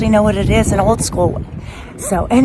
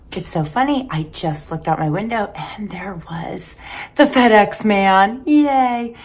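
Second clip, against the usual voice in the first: normal talking voice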